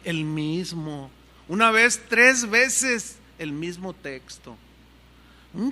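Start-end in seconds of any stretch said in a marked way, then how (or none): none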